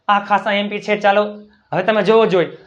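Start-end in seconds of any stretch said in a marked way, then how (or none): none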